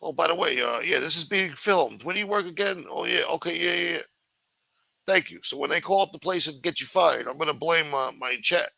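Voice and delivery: high, fake-casual mocking impression; mock streamer voice. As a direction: sneer at the guy filming strangers for his chat. Mocking the guy filming people.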